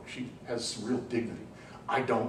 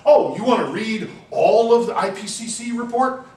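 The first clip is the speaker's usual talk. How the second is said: higher and louder, sneering mock question; mock condescension; 10 semitones higher, 12 dB louder than usual. Communicator to the public, mocking condescending question. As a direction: mimic a smug expert talking down.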